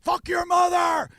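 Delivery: high, loud, drawn-out imitated scream; freaking-out scream. Driver freaking out at the fake police call, screaming. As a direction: explode into a strained, stretched yell. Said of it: "And he freaks".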